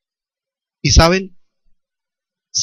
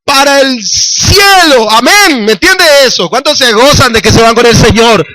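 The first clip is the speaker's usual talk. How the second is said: higher, louder fiery preaching; preaching at full force; 12 semitones higher, 6 dB louder, slower than usual. Acted. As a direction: rouse the congregation about salvation.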